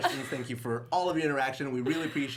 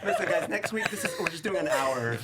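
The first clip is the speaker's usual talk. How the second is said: amused announcement, pitched up with laughter; laughing; 4 semitones higher than usual. Real, not acted. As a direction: talk through real laughter. Host cracking up at giants.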